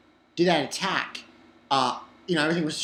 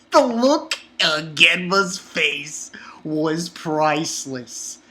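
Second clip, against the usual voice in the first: usual speaking voice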